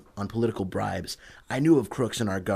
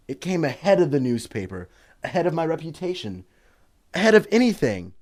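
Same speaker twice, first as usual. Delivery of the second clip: building conviction; 6 semitones higher than usual. Acted. Son justifies himself, building.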